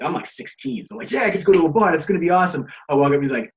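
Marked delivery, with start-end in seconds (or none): 1.1-2.6 re-enacted eager teen excitement, louder and higher; super stoked. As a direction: act out being thrilled to tag along. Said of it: "I'm super stoked".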